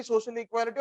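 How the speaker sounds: normal talking voice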